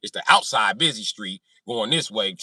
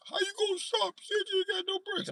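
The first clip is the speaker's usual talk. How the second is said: whiny passengers; 7 dB quieter than usual. Retold or imitated. Scared passengers asking about brakes.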